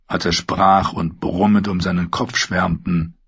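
plain reading voice

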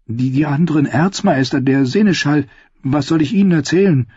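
anxious stammer, unsure what to say. A narrator's reading. Sam stammers, asking what to tell the other Archmaesters. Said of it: "stammelte Sam".

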